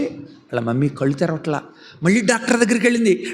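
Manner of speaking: plain reading voice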